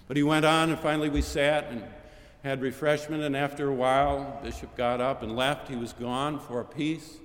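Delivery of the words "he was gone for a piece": usual speaking voice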